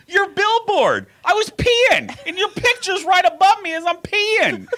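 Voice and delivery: retold high, loud outburst; imitated yelling. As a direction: imitate a friend hollering up in falsetto. Imitates friend yelling her explanation.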